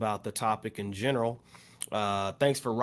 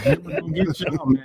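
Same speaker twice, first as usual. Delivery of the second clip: laughing through the words, higher and louder; 4 semitones higher, 6 dB louder than usual. Real, not acted. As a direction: tease them while still laughing. Teasing about Hammer while laughing.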